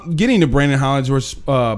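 ordinary talk, nothing marked

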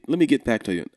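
normal talking voice

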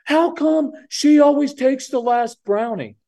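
put-on a kid griping that it's unfair; whining kid. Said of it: "his normal response should be".

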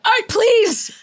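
high excited crack on please; voice cracking with excitement. Real, not acted. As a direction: voice breaking up high with eagerness. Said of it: "Did you hear my voice crack with excitement?".

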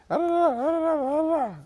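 high, babbling imitation of women talking; mock chattering women.

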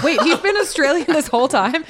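high, loud, laughing disbelief; shocked realization. Retold or imitated. Recalling watching the film, loud laughing surprise. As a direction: blurt it out, half laughing in surprise. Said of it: "I was like".

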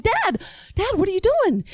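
high, alarmed questioning of Dad; acted alarm. Child catching Dad stuffing gum in his shoes, alarmed. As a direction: pitch it up in mock disbelief.

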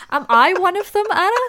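high, loud question through helpless laughter; laughing. Real, not acted. Teasing co-host about her accent mistakes, laughing hard. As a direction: squeal it out, cracking up.

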